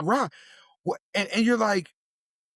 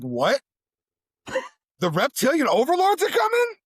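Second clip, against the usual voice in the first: rising, alarmed question, higher; shocked disbelief; 8 semitones higher than usual. Retold or imitated. Kid hearing of reptilians, alarmed and pitched higher. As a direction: gasp at the news.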